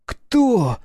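put-on high, loud, angry little boy; angry child voice. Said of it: "тут же начал злиться".

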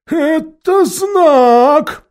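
very slow, high, loud character voice; Khmur's proclamation. A narrator's reading. Khmur recognising the sign, slow high proclamation. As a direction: stretch out the momentous discovery. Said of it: "сказал Хмур".

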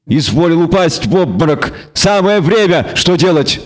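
high, wry goat-devil voice; bleating devil. A narrator's reading. Devil telling the witcher his friend fainted, bleating. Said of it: "тихо проблеял он".

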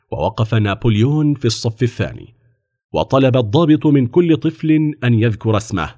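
usual speaking voice

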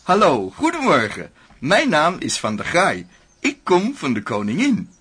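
high, loud, overly chipper greeting; cheerful official. Acted. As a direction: beam at him like a salesman at the door. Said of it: "Meneer, opgewekt". (opgewekte ambtenaar, hoog en luid)

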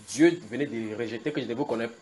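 ordinary talk

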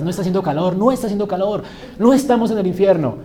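negación burlona que sube de tono y volumen; imitando a los positivistas. Retold or imitated. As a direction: remeda a quien insiste en negar lo evidente. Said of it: "todos los positivistas". (parodia de optimismo terco)

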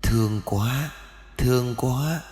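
put-on hushed childlike voice in the father's head; dead son's soft voice comforting his father; soft child's voice. A narrator's reading. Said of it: "giọng nói khe khẽ của trẻ con".

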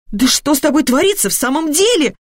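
high, gasped-out dismay; mother appalled, pitch leaping up; aghast. A narrator's reading. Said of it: "ахнула Алёна". (ахнув, ошеломлённо)

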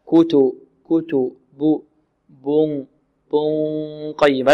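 "بن قيمة" with the noon held as a hum through the nose; nasal ghunnah. Shown as a demonstration. Teacher demonstrates nose over mouth, nasal ghunnah in "بن قيمة". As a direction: let the hum flow mostly from the nose in "بن قيمة". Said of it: "مع غنة تجري من الخيشوم".

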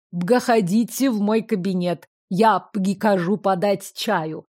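lawyer with a guttural burred r, every r in «Ягослава Елизаговна Ольбганская» rolled in the throat. A narrator's reading. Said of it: "смешным картавым говором".

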